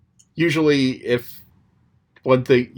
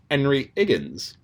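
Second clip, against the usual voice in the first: "Henry Higgins" as 'enry 'iggins, no h; Cockney accent, drop the h on "Henry Higgins"; Cockney, h dropped; Cockney h-dropping; 3 semitones higher than usual. Shown as a demonstration. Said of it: "the Cockney accent drops the H".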